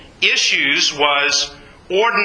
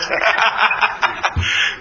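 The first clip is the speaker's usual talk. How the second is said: laughter; 4 semitones higher than usual. Real, not acted. Still laughing at himself.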